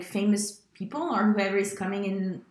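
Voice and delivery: usual speaking voice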